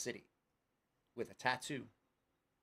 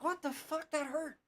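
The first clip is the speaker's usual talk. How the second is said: very high-pitched mock dismay; pained bewilderment. Man retelling scratching his sore arm, pained bewilderment. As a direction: squeal it like a startled complaint.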